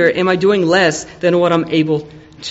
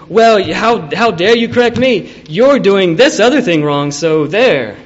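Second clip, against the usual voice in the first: louder, defensive how dare you; indignant retort; 2 semitones higher, 5 dB louder than usual. Retold or imitated. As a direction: snap back, offended. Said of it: "they'll retaliate and say".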